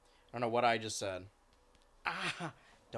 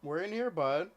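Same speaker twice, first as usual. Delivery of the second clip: 1 calling the cat; 6 semitones higher than usual. Real, not acted.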